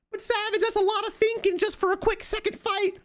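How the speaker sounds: high squeaky mock viewer voice; mock whiny viewer. Coach mocking a viewer who fears claymores, high squeaky voice. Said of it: "mock viewer objection".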